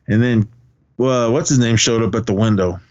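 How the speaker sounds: normal talking voice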